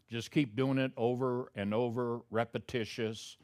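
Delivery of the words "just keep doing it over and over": usual speaking voice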